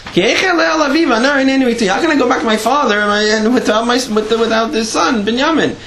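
high, urgent retold entreaty; impassioned plea. Yehuda arguing for his brother's release, pleading. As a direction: plead with the voice pitched high throughout. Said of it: "and he starts up".

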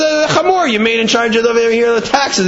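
high-pitched tattling, lightly acted; agitated complaint. Fox reports the donkey to the lion, agitated.

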